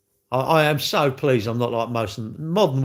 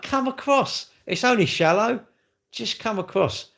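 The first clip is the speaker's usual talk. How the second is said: higher, sing-song coaxing call; sly coaxing; 9 semitones higher than usual. Pretend. Luring an unwanted guest into crocodile water, high coaxing call. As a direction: lure them in sweetly, mock innocent.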